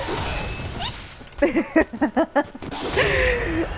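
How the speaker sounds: high startled squeak and laugh; a sudden squeak of nerves; nervous squeak. Real, not acted. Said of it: "I actually just squeaked 'cause I was nervous".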